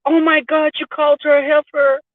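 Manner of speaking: high-pitched mock-scandalized friend. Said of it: "They'd be like".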